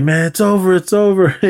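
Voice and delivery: high-pitched mock whining; hammed-up defeated whine; imitated panic. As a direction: play a frightened kid giving up. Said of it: "I'm afraid".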